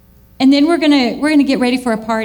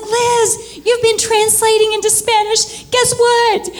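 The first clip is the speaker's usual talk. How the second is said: excited call; 9 semitones higher, slower than usual. Retold or imitated.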